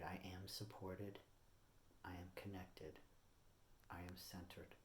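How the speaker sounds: quiet, grounding self-affirmation; soft affirmation. Real, not acted. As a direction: say it softly, settling into each phrase. Guiding calming affirmations in yoga.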